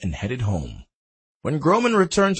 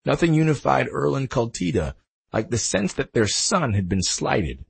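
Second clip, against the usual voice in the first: usual speaking voice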